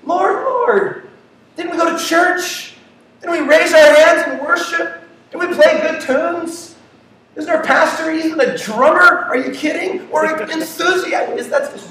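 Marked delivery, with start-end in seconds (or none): 0.1-10.1 high, eager churchgoer pleading his case; high-pitched, eager mock plea; enthusiastic believer. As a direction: play a keen believer boasting to the Lord. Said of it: "they were excited and enthusiastic about".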